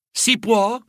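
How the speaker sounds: high, polite little question; polite asking. A narrator's reading. Fiddler at the door, asking politely. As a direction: ask politely to be let in. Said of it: "chiese educatamente".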